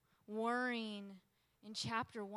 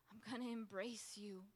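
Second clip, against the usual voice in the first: near-whispered vow; very soft, hushed devotion; embracing surrender; 10 dB quieter than usual. Acted. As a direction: whisper it tenderly to God. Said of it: "to embracing".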